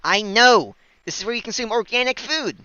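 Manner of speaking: loud, high, overexcited cry; excited shout. Acted. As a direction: burst out in mock glee. Mock excited outburst.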